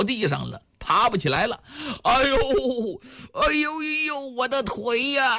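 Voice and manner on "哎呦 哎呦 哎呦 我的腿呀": 拉长的高调哀号; 夸张地装作摔伤喊疼; 痛苦叫唤. A narrator's reading. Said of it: "痛苦地叫起来了". (拖长声音喊疼)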